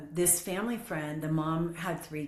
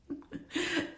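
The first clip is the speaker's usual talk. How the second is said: laughing; 13 semitones higher, 5 dB quieter than usual. Real, not acted. Laughing at own story.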